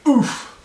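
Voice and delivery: sudden high, laughing "oof"; imitated pained oof. Game master imitating salamander getting hit nearby, high comic oof. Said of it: "you hear the unmistakable sound of salamander".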